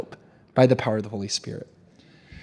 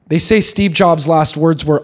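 ordinary talk, nothing marked

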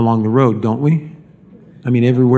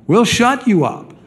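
mock threat; 9 semitones higher than usual. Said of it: "there was a warning".

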